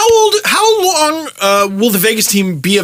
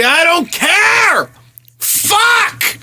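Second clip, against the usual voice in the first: exasperated yell; 10 semitones higher, much slower than usual. Real, not acted. Exasperated rant about lockout.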